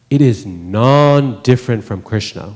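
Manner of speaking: slow, weighty, stress on "non-different"; said with emphasis. Shown as a demonstration. Teacher stating the internal energy, slow and emphatic. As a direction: press down on "non-different from Krishna". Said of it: "We say that with emphasis".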